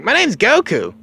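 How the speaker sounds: put-on high, loud kid voice; Goku. Goku answering the Ox King, high-voiced. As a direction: introduce yourself in a high character voice. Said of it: "Goku's like".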